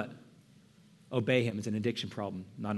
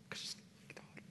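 tearful breathy whisper with a laugh; whispered through emotion; choked whisper; 20 dB quieter than usual. Real, not acted. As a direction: barely get the words out. Emotional confession to congregation.

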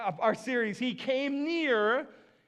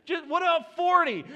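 higher, haggling nudge; 7 semitones higher, 3 dB louder than usual. Retold or imitated. Abraham haggling with God over numbers, coaxing. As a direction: nudge the price down a little. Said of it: "I love the negotiation".

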